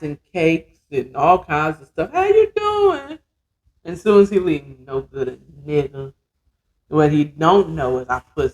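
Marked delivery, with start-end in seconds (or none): 2.1-3.2 high, drawn-out sing-song hello; exaggeratedly nice, high-pitched greeting; fake-friendly greeting. Acts fake-nice greeting to hated man. 4.8-6.1 low, quiet grumble behind his back; soft, low aside of contempt; muttered insult. Pretend. Mutters insult once he leaves.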